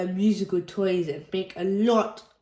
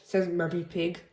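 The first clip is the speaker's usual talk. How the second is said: ordinary delivery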